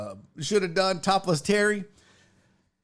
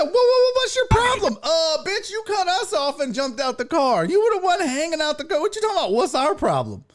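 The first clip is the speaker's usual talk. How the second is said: mock angry woman; 7 semitones higher than usual. Retelling the clip, voices the woman shouting at him in a high mock yell. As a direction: act out the woman giving him an earful. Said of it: "She said".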